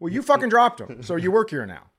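high, loud mock outrage; indignant retort. Customer telling off a napkin dropper, loud mock indignation.

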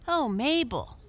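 put-on high-pitched excited boy; boy crying out. A narrator's reading. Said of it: "cried a boy".

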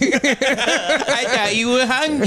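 put-on high, slow baby talk. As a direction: coo it like a baby. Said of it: "I did baby voice".

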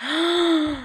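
a high gasp of delight. Real, not acted. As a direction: gasp at the photo. Sees her favorite girl's mugshot.

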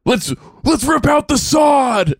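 slow, high rallying yell; riled-up shout. Retold or imitated. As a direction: whip up the crowd. Imagined concertgoer, riled up.

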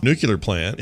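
"nuclear" as NOO-kyuh-ler in "nuclear plant"; slipped pronunciation. Shown as a demonstration. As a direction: let "nuclear" slip into nook-yuh-ler. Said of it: "it comes out wrong".

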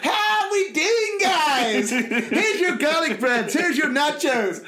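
mock waiter. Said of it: "Pizza Hut Pete comes over".

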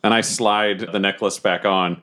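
ordinary delivery

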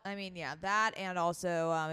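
ordinary delivery

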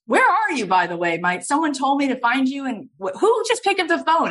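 high, insistent caller firing questions; acting out an impatient caller, pitch leaping up; hammed-up urgent phone demand; Steven Spielberg. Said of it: "it's Steven Spielberg".

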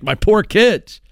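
normal talking voice

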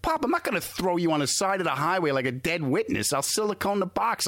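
mock exasperated promise; retold exasperated son. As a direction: argue with a worried old dad. Son reassures dad in retold argument.

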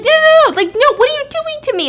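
very high, loud, acted panic; hammed-up panicked protest, voice shooting up; freaking out. Patient on operating table to doctors, freaking out. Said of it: "he starts freaking out".

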